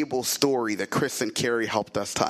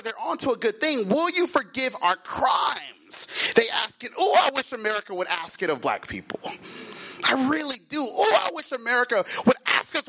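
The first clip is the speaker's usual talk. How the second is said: high, urgent pulpit appeal; impassioned preaching; 10 semitones higher than usual. Real, not acted. Preacher pleading for America to ask forgiveness, impassioned preaching. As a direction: preach it fervently, pitch raised and driving.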